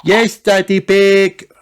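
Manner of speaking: put-on high, slow chorus of kids; children answering together. A narrator's reading. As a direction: sing it back like a happy group. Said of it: "everyone answered back".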